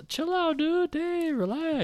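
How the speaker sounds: high-pitched exasperated plea to relax; mock calming down. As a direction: tell them to chill, voice pushed way up. Acted reply to screaming wife.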